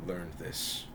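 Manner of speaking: low, soft, measured reproach; stern princess correction. Acted. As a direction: chide him quietly. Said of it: "She goes".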